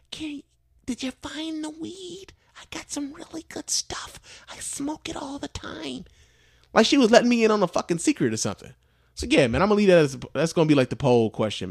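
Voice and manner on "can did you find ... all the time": high, hushed conspiratorial granny fading to a whisper; old lady voice. Retold or imitated. Old lady bragging to the worker about her weed, high hushed voice. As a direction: tempt him softly like a secret.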